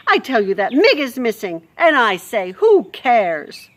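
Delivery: loud harsh Louise. Acted. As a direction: rant about the dungeon news.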